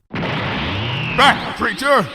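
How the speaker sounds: high, forceful royal shout; thundering command. Acted. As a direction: drive the beast away. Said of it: "she thundered".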